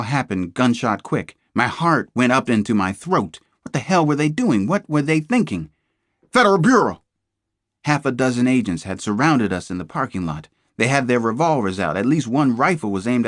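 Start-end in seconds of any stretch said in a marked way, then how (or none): 6.3-7.0 much higher, much louder yell; a piercing police yell; full shout. A narrator's reading. FBI agents storm in.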